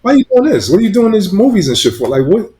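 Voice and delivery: high, disbelieving questions; incredulous cop. Retold or imitated. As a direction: ask in shocked disbelief. Retold cop questioning him.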